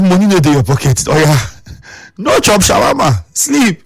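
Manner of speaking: playful ridicule of a broke friend; mocking tease. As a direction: rub it in with a laugh. Retells teasing a friend about money.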